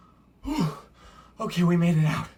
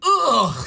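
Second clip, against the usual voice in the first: loud, high "ugh" of disgust; hammed-up revolted cry; disgusted innkeeper's groan; 8 semitones higher, 10 dB louder than usual. A narrator's reading. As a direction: recoil with a big storybook groan. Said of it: "said the innkeeper".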